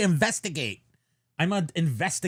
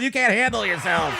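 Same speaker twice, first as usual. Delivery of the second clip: playful shout; 8 semitones higher, 4 dB louder than usual. Real, not acted. Teasing shout at partner.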